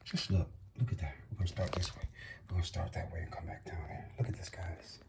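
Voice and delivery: low, very soft near-whisper; barely audible, low-pitched hush; hushed awe. Real, not acted. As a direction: murmur it low to the viewers. Hushed awe showing the gift.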